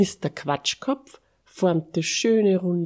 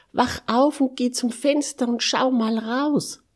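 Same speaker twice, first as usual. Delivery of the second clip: high, loud, urgent call; excited calling out; 9 semitones higher, 5 dB louder than usual. A narrator's reading. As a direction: rouse a sleepy friend with a loud, high voice. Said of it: "rief Mister Quatschkopf". (aufgeregt und hoch rufen)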